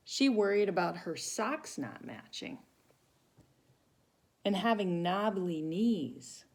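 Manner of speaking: put-on lively read-aloud for children; storybook reading. Teacher reading to children, lively storytelling voice. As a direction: tell it like a picture book to a class. Reads picture book to children.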